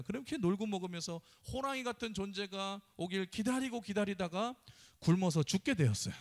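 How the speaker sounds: usual speaking voice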